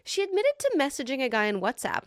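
usual speaking voice

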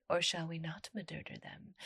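breathy whispered aside; whispering. Acted. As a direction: whisper it like a secret. Playful aside to the audience.

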